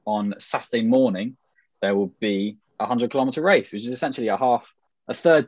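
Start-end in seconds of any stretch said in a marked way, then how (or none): none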